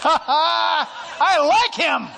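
loud, slow, laughing exclamation; high, loud laughter through the words; laughing delight. Real, not acted. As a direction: burst out laughing with joy.

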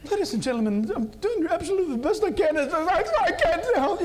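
high, loud mock British captain losing composure; hammed-up British pilot pleading over the cabin speaker; frantic apologetic pilot. British pilot reassuring passengers in turbulence, frantic mock British accent. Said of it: "he's, like, apologizing".

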